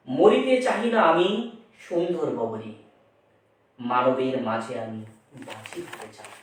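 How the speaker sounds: slow, dropping poetic reading; slow solemn verse reading; poem recitation. Acted. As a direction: recite the verse slowly and let it fall away. Recites the example poem line.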